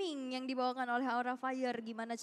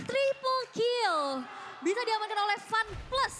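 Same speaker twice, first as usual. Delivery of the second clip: excited; 5 semitones higher, 5 dB louder than usual. Real, not acted. Caster hyping a triple kill.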